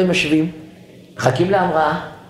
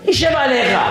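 mock angry retort; 7 semitones higher than usual. Acted quarrel, passenger snaps back.

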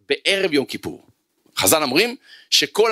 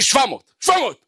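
rising higher with each repeat; exaggerated fury; 8 semitones higher than usual. Angry student telling the rabbi his anger level, climbing higher. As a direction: insist it is off the scale. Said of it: "אמר לי".

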